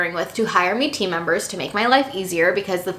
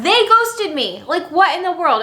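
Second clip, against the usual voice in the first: outraged disbelief; 8 semitones higher than usual. Real, not acted. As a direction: stretch out every word in disbelief. Outraged retelling.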